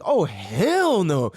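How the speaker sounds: slow, drawn-out refusal; retold defiance. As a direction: stretch it like you won't accept it. Reacting to losing race.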